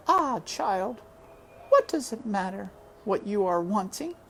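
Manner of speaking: put-on mother asking her child to release her; dying mother.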